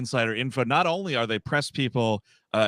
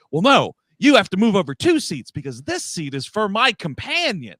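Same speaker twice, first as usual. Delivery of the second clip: indignant lady; 6 semitones higher, 6 dB louder than usual. Retold or imitated. Lady insisting on seat.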